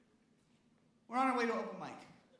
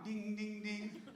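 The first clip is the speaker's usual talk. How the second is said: crossing bell imitation; 7 dB quieter, slower than usual. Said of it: "imitating bell again".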